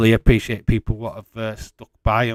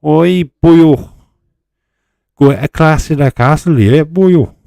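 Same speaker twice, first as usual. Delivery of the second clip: mock Welsh; 6 semitones higher, 11 dB louder than usual. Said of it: "I am Welsh".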